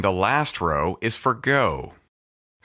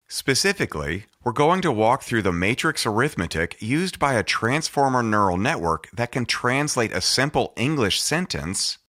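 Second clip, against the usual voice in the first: ordinary talk, nothing marked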